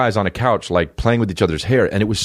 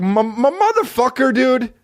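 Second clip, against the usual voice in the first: much higher, stunned protest; outraged disbelief. Retold or imitated. Comedian reacting to the insult, high and stunned. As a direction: squeal it in offended shock. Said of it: "And I'm like".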